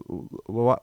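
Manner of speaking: ordinary talk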